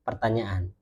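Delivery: usual speaking voice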